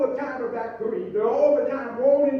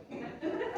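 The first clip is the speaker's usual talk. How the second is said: high giggle; 9 semitones higher, 13 dB quieter than usual. Real, not acted. Laughing at wife's threat story.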